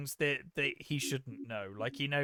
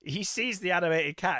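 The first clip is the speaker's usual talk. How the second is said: usual speaking voice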